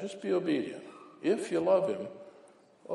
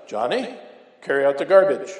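mom giving an order; 8 dB louder than usual. Retold or imitated. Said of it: "you tell your son".

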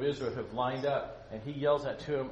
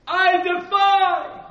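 slow, huge roaring giant voice at the top of the lungs; booming Goliath; 17 dB louder, much slower than usual. Acted. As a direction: bellow the challenge like a giant warrior. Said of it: "it's better if I do it this way".

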